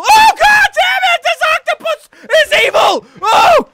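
rage-yell while laughing; furious shouting. Real, not acted. Raging at octopus attack.

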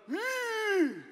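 put-on high, drawn-out baby hmm; high mock baby hum of defiance; baby's hmm. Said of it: "he just looks at me and goes".

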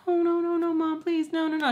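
high-pitched imitation of desperate pleading; retold pleading. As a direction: beg mom in a high mock whine. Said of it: "And I'm like".

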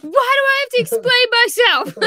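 high, loud imitation of someone losing it; mock flip-out. Cohost retelling Dan's flip-out to chat, mock irate yell. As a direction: act out his outburst, hammed-up and indignant. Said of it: "He lost it".

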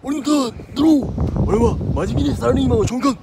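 high, loud anime duelist yell; card duel shout. Acted. Card game duel parody, shouting.

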